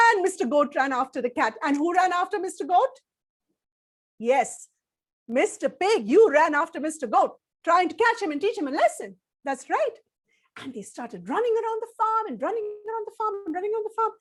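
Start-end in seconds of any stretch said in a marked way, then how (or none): none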